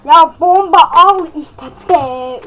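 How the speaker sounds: slow, loud, drawn-out exasperation; exasperated. Real, not acted. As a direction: spell it out to a useless teammate. Annoyed at teammate not buying. (bıkkın, yavaş ve yüksek sesle sitem)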